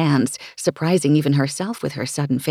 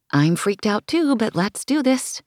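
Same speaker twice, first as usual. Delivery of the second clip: put-on higher, nervous girlish voice; nervous young woman; 7 semitones higher than usual. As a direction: voice the scared friend, pitched up. Nervous friend's look, voiced.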